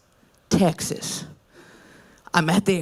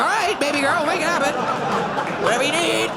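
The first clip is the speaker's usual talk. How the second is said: higher, coaxing indulgent voice; mock indulgent; 6 semitones higher, faster than usual. Comedian voicing adults spoiling the kid, sweetly higher. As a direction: humor the child, sweetly.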